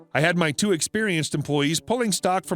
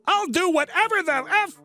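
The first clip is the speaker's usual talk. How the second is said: defiant shopper; 13 semitones higher than usual. Retold or imitated. Rude customer defying the store manager, high put-on snarl. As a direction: spit it back like a furious customer.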